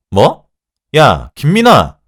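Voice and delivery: loud, high, drawn-out call; outraged yell. A narrator's reading. Mistress yelling at her junior after being insulted, outraged. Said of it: "라고 소리를 질렀죠".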